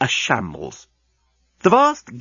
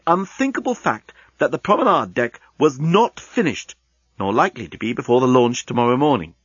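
ordinary delivery

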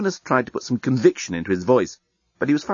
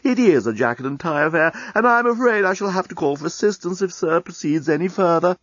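a lightly raised, fussy butler-robot voice; prim maitre d' robot; 6 semitones higher than usual. Acted. Robot maitre d' scolding an underdressed guest, prim and fussy. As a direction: politely refuse the guest as a stiff robot waiter. Maitre d' bot addressing Leovinus.

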